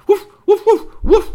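loud high woofs; sharp imitated dog woofs; dog barking. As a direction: bark like an excited dog. Maxie barks again.

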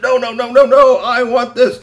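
put-on a kid wailing that he wants it; loud whiny protest. Storyteller voicing the boy loudly refusing to give up. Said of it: "Strongbones said".

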